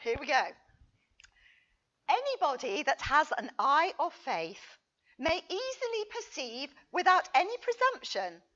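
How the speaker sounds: normal talking voice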